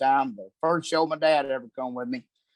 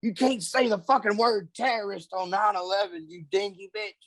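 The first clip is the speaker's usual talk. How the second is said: scolding the wife; 6 semitones higher than usual. Retold or imitated. Husband retelling how he scolded his wife at the airport, exasperated high-pitched rebuke. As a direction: hiss a rebuke at her in disbelief. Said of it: "I'm like".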